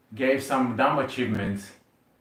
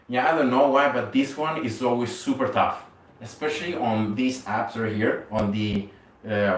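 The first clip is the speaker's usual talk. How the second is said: ordinary talk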